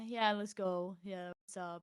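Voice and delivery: chill, low-key imitation; Adam. Imitating a chill partner's easy reply, soft and low-key. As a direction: say it softly and casually, imitating a laid-back guy. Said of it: "'Cause Adam is chill".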